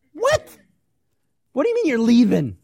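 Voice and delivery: very high squeaked what, then loud; disbelieving cry; shocked what. Retold or imitated. Disciples hearing Jesus is leaving, shocked.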